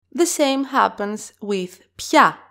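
normal talking voice

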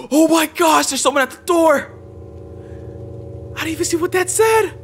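startled cry. Real, not acted. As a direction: jolt as the knock hits. Sudden knock at the door.

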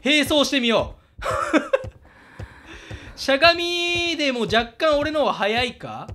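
ordinary talk, nothing marked

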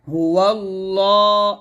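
"হুয়াল্লাহ" chanted slowly, the ল vowel drawn out; one-alif elongation. Shown as a demonstration. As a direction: stretch the second syllable of "হুয়াল্লাহ" for one beat. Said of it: "ল এক লিপ টান দিবেন".

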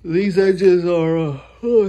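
talking through a yawn. Real, not acted. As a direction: yawn mid-sentence. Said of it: "excuse me for yawning on y'all".

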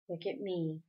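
low, quiet order; commanding. A narrator's reading. Father orders his unresponsive son, quietly commanding. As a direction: command without raising your voice. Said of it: "Mister Murray commanded".

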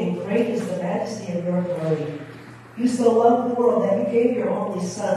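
ordinary delivery

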